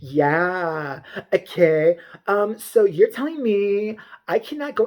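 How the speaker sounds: drawn-out, mocking customer voice; slow imitation of an affronted shopper; mock entitled Karen. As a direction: drag the words in mock disbelief.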